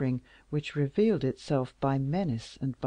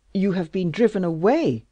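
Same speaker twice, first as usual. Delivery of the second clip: loud, falling exclamation; a king crying out in shock; exclaimed; 3 semitones higher, 8 dB louder than usual. Acted. King exclaiming to Louise, loud. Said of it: "exclaimed the king".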